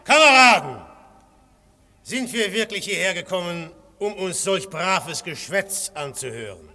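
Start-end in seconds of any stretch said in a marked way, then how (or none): 0.0-0.8 rousing address. Acted. Syme opens his speech.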